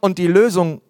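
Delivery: ordinary delivery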